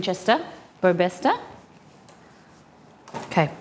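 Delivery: ordinary talk, nothing marked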